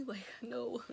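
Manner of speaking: breathless whispered no. Real, not acted. Teen dreading mom's call, whispered refusal. Dreading mom being called.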